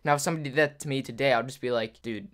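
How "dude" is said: low, soft, casual dismissal; unimpressed. Retold or imitated. Older self dismissing a plastic knife threat, low-key and unimpressed. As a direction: brush off the threat without effort.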